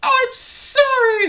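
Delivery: very high, drawn-out mock begging; hammed-up high-pitched desperate apology; grovelling plea. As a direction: beg on your knees in a squeaky wail. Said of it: "You're on your knees saying".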